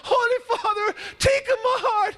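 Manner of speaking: highest, strained pleading shout; frantic yelling. Acted. Crowd yelling frantically as the feather almost lands, very high. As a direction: scream the plea as it nearly lands. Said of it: "they're yelling".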